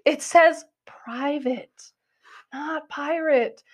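exasperated, drawn-out imitation of a friend; imitated exasperated correction. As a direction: spell it out slowly, word by word, as to a dim friend. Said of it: "Dennis is like".

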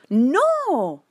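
protesting. A narrator's reading. Girl defends the moon to policeman, drawn-out protest. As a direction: deny it high and long.

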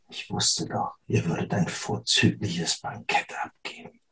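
sinister whisper in the ear; whispering a secret. Acted. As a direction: breathe it into her ear, menacing. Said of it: "flüstert dir ins Ohr".

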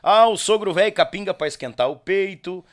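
ordinary talk, nothing marked

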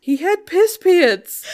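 high, loud, drawn-out protest; laughing protest. Real, not acted. Host defending a blunt phrase, laughing in protest. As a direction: protest slowly and loudly through laughter.

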